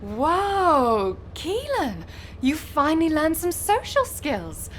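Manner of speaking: high, drawn-out mock amazement; swooping, playful astonishment; teasing amazement. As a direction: tease him with exaggerated wonder. Teasing a colleague who finally answered.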